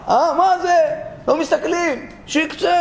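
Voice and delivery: high-pitched acted recoil; scandalized alarm. Righteous men seeing a woman's loose hair, scandalized.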